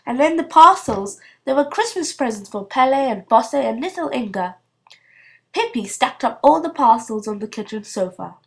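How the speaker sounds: plain reading voice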